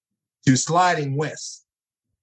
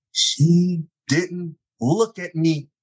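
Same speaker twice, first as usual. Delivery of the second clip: usual speaking voice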